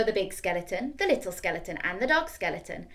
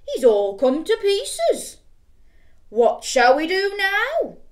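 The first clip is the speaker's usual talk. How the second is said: high, loud, slow puzzled voice; big skeleton; 9 semitones higher, 9 dB louder, slower than usual. Acted. Big skeleton asks friend, high slow and loud. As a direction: ponder it aloud, big and slow.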